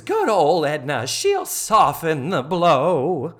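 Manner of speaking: loud, slow, quavering theatrical lament; Missus Garrett. Retold or imitated. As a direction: drag it out as a hammed-up martyr. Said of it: "I won't do it in that voice".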